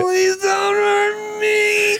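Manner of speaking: high, drawn-out put-on wail; hammed-up cowardly shriek for mercy; mock terrified begging. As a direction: play the fake victim, slow and squealing. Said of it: "becomes a different person".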